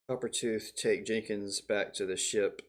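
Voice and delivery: normal talking voice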